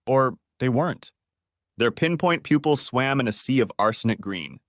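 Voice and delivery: plain reading voice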